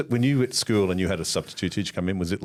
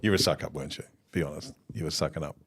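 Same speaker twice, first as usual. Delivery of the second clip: teasing whisper; 6 semitones lower, 7 dB quieter than usual. Real, not acted. Friend needling Connor for being a suck-up, sly hushed tease. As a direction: murmur it like a secret accusation.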